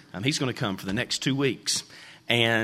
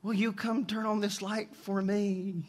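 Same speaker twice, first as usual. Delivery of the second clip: put-on higher, softer, slow child plea; a small boy asking mom, pitched up and soft; boy calling mom; 6 semitones higher, 7 dB quieter, slower than usual. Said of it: "the little boy screams to the mom".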